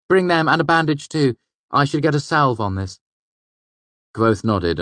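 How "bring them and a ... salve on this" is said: put-on higher, lively young trickster voice; young fey man voice. A narrator's reading. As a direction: voice Bast higher, quick and sly. Bast voiced by narrator.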